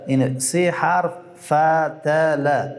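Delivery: slow drawn-out letters, spelled for students; slow teaching demo. Shown as a demonstration. Teacher spelling letters to the class, slowly. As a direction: draw each letter out for the class. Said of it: "سه حرف پای در پای چی آمد".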